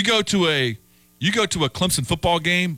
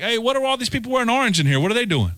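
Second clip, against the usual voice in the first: lightly voiced puzzled local, higher and fast; 8 semitones higher, faster than usual. Host mimics a confused T rider, mildly higher and fast. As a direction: play a baffled Bostonian asking about the orange crowd, higher and quick.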